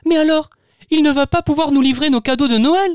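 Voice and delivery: put-on high child voice, anxious question; a little kid fearing no presents; worried child asking. Tutu asking Booster about the presents, high worried child voice. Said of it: "l'interroge Tutu".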